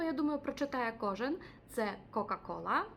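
ordinary talk

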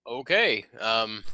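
lord's welcome broken by laughter; laughing lord. Acted. Lord greets a rude adventurer, laughing through. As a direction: try to stay in character but crack up.

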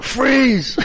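high, loud laughing yell of freeze; mock shout. Retelling Dave's shout in the dark, laughing mock yell. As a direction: bark it like a soldier, laughing. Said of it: "Dave was like".

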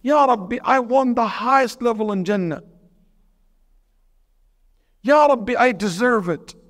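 put-on pleading old woman. Grandmother pleading to God.

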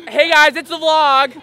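loud, high, drawn-out greeting; calling to camera. Acted. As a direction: holler a slow vlog intro. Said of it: "told me to say that to the camera".

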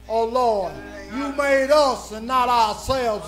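ordinary talk

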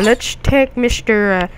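ordinary talk

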